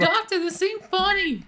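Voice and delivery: high, drawn-out New Jersey complaint; comic high-pitched New Jersey nurse; New Jersey accent. Acted. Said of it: "it's a New Jersey".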